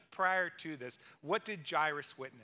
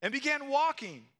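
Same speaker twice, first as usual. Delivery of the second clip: higher, louder, thrilled retelling; rising wonder; 5 semitones higher, 5 dB louder than usual. Acted. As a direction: lift it like a miracle unfolding. Miracle climax, voice rises.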